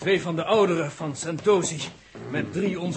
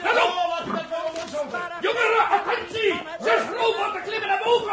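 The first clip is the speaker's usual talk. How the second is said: alarm call; 11 semitones higher than usual. Acted. Elder alerts youths of robots.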